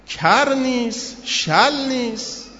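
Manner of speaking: each word drawn out very slowly, high; amazed groom stressing each denial, very slow; slow emphatic listing. Acted. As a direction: let every denial land slowly. Groom listing bride's beauty.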